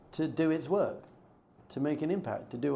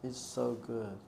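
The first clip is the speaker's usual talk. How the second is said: savoring whisper; 4 semitones lower, 12 dB quieter, much slower than usual. Retold or imitated. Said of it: "I was like".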